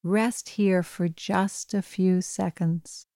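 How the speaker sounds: calm, even bedtime meditation voice; guided meditation. Acted. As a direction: gently guide a listener toward sleep. Leads a bedtime breathing meditation.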